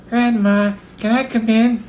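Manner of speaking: put-on high-pitched little-girl shout; little girl calling. A narrator's reading. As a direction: call through the door as a small girl. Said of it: "she called".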